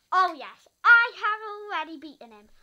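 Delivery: loud victorious shout; triumphant shout. Pretend. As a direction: yell it out in triumph. Victory over villain.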